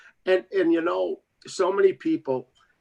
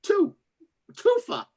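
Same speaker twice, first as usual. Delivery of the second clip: much higher, teasing spirits' voice. Retold or imitated. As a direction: sing out playfully high. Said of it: "then they're going".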